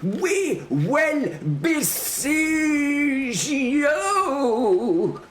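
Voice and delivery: put-on very high, drawn-out accented yell; herald's shout. A narrator's reading. Herald repeats his siege threat, high shout. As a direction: shriek the threat, stretching each word. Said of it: "The herald shouted".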